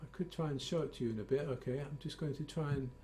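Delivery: soft, trailing away from the mic; fading away. Real, not acted. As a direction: voice drifting off quietly. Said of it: "Your voice is fading away".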